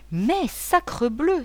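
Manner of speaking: sacrebleu cried out high and drawn out slowly; a slow, high, exasperated outcry; exclaiming. Acted. Painter suddenly rising, exclaiming in frustration. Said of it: "s'écria-t-il".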